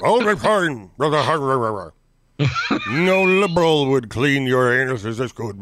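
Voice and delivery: Nixon-like blustering pundit voice; slow, rumbling caricature of a pundit; Lou Dobbs impression. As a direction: do a rough Nixon-style impression. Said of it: "my Lou Dobbs sounds a lot like my Nixon".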